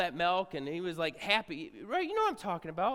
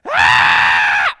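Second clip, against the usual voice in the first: imitated baby scream; 13 semitones lower, 22 dB louder than usual. Newborn screaming at night.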